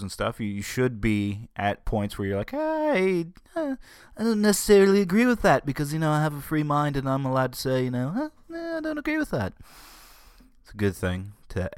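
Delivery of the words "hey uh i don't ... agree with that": squeaky, very high mock objection; put-on dissenting listener. Imagined listener talks back to a radio host, high mock protest. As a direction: pipe up in a silly high-pitched voice.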